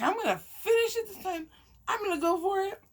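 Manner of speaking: high, wavering tearful voice. Real, not acted. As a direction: push the words out through tears. Still teary.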